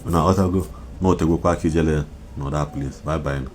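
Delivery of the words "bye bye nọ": normal talking voice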